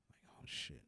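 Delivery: hushed whisper, caught in the act. Pretend. A kid alone at home doing his mom's taxes, whispering. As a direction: breathe it like someone might hear.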